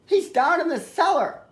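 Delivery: put-on high-pitched, flustered boy; urgent boy. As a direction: point the policeman on urgently. Boy urgently directs policeman.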